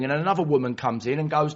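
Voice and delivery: ordinary talk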